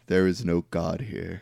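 low, slow, softened solemn voice; imitating a grave, stoic movie figure. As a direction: intone it gravely, deep and measured.

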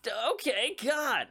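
high-pitched exasperated backing off; mock exasperated surrender; retold exasperation. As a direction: back off with a high, put-upon protest. Retold reply to offended dad.

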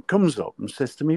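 usual speaking voice

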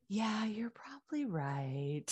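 imitating mom in a whisper; hushed. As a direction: breathe it out meekly, almost no voice. Mom's hushed reply retold.

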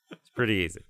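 ordinary delivery

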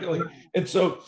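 ordinary talk

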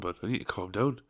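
normal talking voice

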